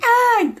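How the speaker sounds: sudden high fearful scream. Retold or imitated. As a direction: shriek at the fly. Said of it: "I'm like".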